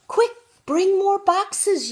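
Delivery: high, loud urgent shout; yelling. Acted. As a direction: yell it in a panic. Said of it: "yelled the man".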